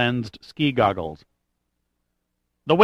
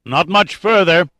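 raised, higher voice over the wind; calling over wind; 6 semitones higher, 5 dB louder than usual. A narrator's reading. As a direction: call it out to be heard over a howling wind. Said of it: "he said over the howl of the wind".

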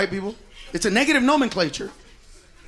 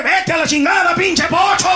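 loud, higher mock insult in Spanish; Mexicans insulting; 6 semitones higher, 4 dB louder than usual. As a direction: sneer it like a local in Mexico. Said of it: "We go over there".

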